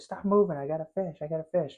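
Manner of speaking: hurried, excited retelling of a call; retold urgent call. Angler tells his buddy he has hooked a fish, retold urgent call.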